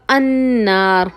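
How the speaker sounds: अन्नार said slowly with a long doubled n; slow careful pronunciation. Shown as a demonstration. Teacher slowly modelling अन्नार for students. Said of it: "अल नार नहीं होगा".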